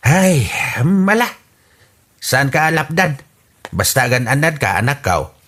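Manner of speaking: ordinary talk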